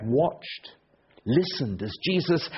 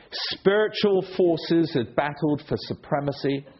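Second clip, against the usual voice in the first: usual speaking voice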